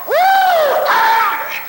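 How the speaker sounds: high, whooping hallelujah; hollering. Retold or imitated. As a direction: holler it in loud praise. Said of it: "I'd run over and holler".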